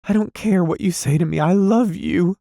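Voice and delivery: tumbling out in a desperate rush; woman begs him in a high cry. A narrator's reading. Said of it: "she cried".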